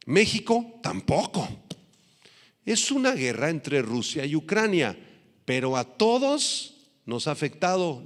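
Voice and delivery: high, loud building preaching; fervent preaching. Acted. Preacher rallying the congregation about rising prices, fervent and loud.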